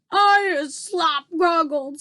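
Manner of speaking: high, loud, slow squawk; squawking giant. A narrator's reading. Trapped giant squawks from his chains. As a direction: squawk like an outraged bird-ogre. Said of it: "squawked the gizzard gulper".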